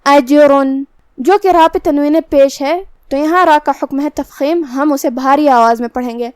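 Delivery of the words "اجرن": اجرن with the jeem bounced, a small echo after it; qalqala on jeem. Shown as a demonstration. Teacher showing qalqala, jeem bounced in اجرن. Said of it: "ہم جیم کو ہلاتے ہوئے یہ کلمہ کو ادا کریں گے".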